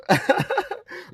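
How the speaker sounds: high breathy scoffing laugh; scornful airy laugh; derisive laugh. Real, not acted. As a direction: laugh at them, unvoiced and high. Taunting spell workers.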